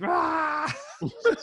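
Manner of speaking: a high, loud burst of laughter; shrieking laugh. Real, not acted. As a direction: let a yelp of laughter break out. Bursting out about lockdown.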